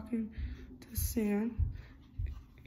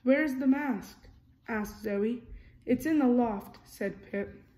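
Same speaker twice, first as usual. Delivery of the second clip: lively storybook reading, character lines a bit louder; reading to children; 5 dB louder than usual. A narrator's reading. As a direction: read it like a bedtime picture book. Reading picture book aloud.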